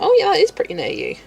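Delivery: high, loud, quick imitation of an American; mock American reaction. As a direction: play an American breezily dismissing the distance. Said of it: "if you're in the U S, you're like".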